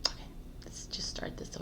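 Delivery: breathy whispered aside to herself. Real, not acted. Vlogger restarting her intro, whispered to herself.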